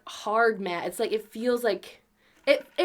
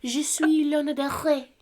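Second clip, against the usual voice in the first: higher mock French accent with throaty R; hammed-up French pronunciation; 5 semitones higher than usual. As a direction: introduce yourself in playful French. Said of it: "in a French accent".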